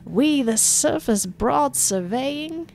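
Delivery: high and very slow; milk every word; hammed-up governor verse; pompous poem reading. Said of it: "reads from his hollow palm".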